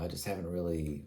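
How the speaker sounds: ordinary talk, nothing marked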